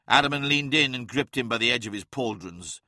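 usual speaking voice

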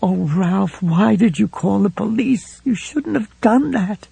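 a wife breaking down in fear; tearful panic. A narrator's reading. Helen asking Ralph where she'll go, tearful.

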